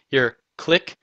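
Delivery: ironic "clique" with a rising lilt; mock-ironic "your clique", tilted up at the end; air quotes. Shown as a demonstration. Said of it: "air quotes".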